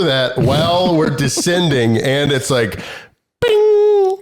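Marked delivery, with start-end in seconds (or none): 3.4-4.2 high, ringing sung "bing"; imitated cabin chime. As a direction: mimic an airplane's announcement bell.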